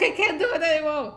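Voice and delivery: high-pitched mock despair; mock breakdown. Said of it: "break down".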